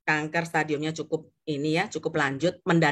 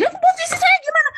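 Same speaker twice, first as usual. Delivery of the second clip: high, loud yelling protest; angry outburst; 7 dB louder than usual. Acted. Client interrupting the agent's advice, angry outburst. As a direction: cut in with a very high, agitated shout.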